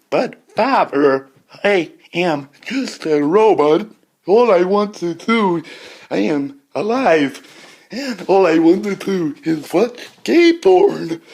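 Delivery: loud, high, slow robot voice; drawn-out pleading robot. Acted. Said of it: "the robot voice".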